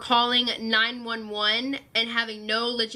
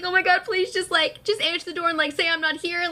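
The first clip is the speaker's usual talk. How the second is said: high, frantic begging; panicked pleading; 5 semitones higher than usual. Retold or imitated. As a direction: beg mom in a panic, voice up high. Begging mom to lie.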